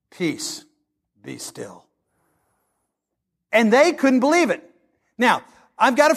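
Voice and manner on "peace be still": soft, low and calm, fading to nothing; Jesus hushing the storm, very soft and low; hushed command. Retold or imitated. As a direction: still the sea with a near whisper.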